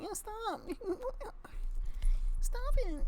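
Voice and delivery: put-on very high squeaky little-kid voice; high child voice with a laugh in it; childhood voice. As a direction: pitch way up like a small boy whining stop. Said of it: "That's how I sounded as a child".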